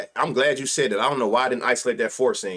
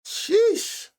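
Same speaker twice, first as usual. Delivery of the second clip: amazed sheesh. Real, not acted. Reacting to a huge home run.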